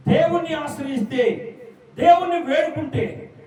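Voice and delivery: pleading preacher climax; fervent preaching. Acted. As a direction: build the appeal to the congregation. Preaching at full force.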